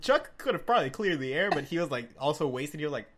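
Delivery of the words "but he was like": usual speaking voice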